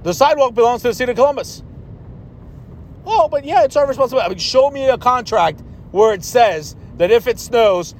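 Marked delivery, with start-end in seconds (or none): 3.0-4.2 higher-pitched imitation of a protesting wife; put-on higher nagging voice; mock wife objection. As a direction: mimic the objection in a raised pitch. Mimicking wife's objection about sidewalk.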